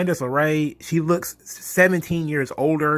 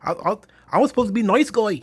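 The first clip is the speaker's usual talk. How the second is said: high-pitched, hammed-up Aussie accent; mocking Australian impression, high and exaggerated; crazy mock Australian accent; 8 semitones higher, 3 dB louder than usual. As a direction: put on a shaky guess at an Australian accent, pitched up. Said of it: "like, a, this crazy accent".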